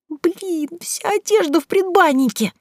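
very high, dismayed squeak; panicked realisation. A narrator's reading. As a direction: voice jumps right up in alarm. Panic over clothes left outside.

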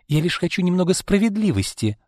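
high-pitched protest; objecting. A narrator's reading. Izolda argues with Arkady over the shares, objecting. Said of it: "возразила она".

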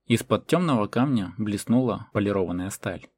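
ordinary delivery, nothing marked

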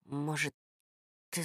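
whispering so no one else hears; whispered. Acted. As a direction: keep it secret, close to the ear. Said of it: "прошептал мне Женчик на ухо".

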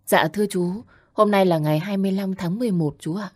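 gentle smiling reply. Acted. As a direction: answer kindly with a smile. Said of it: "cười dịu đáp".

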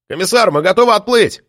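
calling out across the boats; shouting. A narrator's reading. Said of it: "крикнул один из полицейских".

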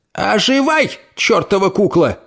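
high sharp self-scolding cry; scolding shout. Acted. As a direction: snap at yourself. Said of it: "прикрикнула на себя".

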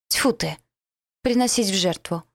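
usual speaking voice

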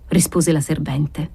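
ordinary delivery, nothing marked

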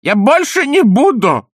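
high, loud, desperate begging; Panikovsky wailing to Ostap not to throw him out; pleading. A narrator's reading. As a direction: beg for mercy at the top of your voice. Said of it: "взмолился Паниковский".

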